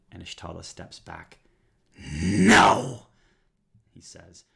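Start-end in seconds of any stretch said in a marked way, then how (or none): 2.0-3.1 a sudden roaring no; furious shout. Acted. Villain Anishtala recoils from the Thorn Mother, roaring. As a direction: bellow your refusal. Villain steps back in fury.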